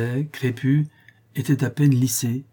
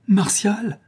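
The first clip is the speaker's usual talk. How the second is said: alarmed; 9 semitones higher than usual. A narrator's reading. La Louve alarmed about her man.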